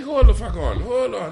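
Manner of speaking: high, laughing hold on; laughing protest. Retold or imitated. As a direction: stop her, half laughing. Laughs in disbelief.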